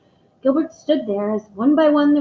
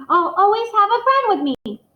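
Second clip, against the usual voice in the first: blurted; 11 semitones higher, 5 dB louder than usual. Acted. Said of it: "blurted out".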